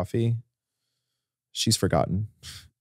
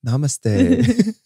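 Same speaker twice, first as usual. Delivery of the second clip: high airy yogi greeting; hammed-up spiritual hippie; 10 semitones higher, 3 dB louder than usual. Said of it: "came back with braids, I'm like".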